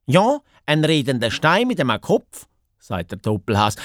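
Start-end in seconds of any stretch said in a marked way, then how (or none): 0.1-2.5 higher-pitched, insistent hare voice; excited hare. Acted. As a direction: confirm it eagerly, pitched up. (ufgregte Haas)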